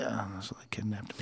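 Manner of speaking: soft breathy whisper. Pretend. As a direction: whisper it like a radio voice.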